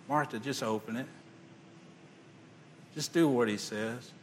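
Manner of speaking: soft, teary plea to her sister; tearful plea. Acted. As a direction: hush it through tears. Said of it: "tears in her eyes".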